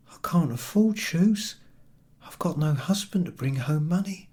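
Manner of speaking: a hint of a tired young mother; young mother pleading. A narrator's reading. Poor young mother replies.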